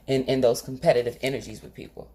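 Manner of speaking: usual speaking voice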